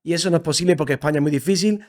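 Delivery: usual speaking voice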